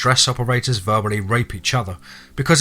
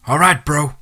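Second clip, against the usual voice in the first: raised, higher call back; brief mild holler; 6 semitones higher, 4 dB louder than usual. A narrator's reading. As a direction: holler a quick reply. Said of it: "Connor hollered back".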